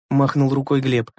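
usual speaking voice

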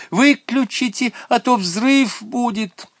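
high, slow urgent yell; alarmed girl's cry. A narrator's reading. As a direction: cry out a warning of disaster. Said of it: "закричала Наташа".

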